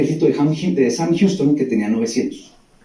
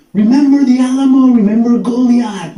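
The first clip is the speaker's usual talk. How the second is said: high, loud, drawn-out rallying cry; imitating soldiers' war cry; battle-cry shout; 8 semitones higher, 5 dB louder, slower than usual. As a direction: shout it like charging troops. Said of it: "Los tejanos gritaban".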